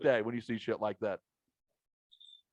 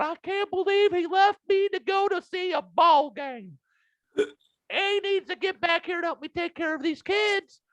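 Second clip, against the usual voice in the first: much higher put-on voice, louder at first; mock abandoned sister; 3 dB louder than usual. Mocking the pregnant sister left behind at the trailer park, high put-on voice. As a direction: ham up a heartbroken sister left at home. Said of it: "pregnant sister right now going".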